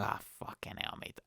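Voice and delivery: fed-up whispered swear, no voice; whispered curse. Retold or imitated. Cold guy whispers his frustration at walking to Costa. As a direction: mutter it to yourself, unvoiced. Muttered reaction on the date.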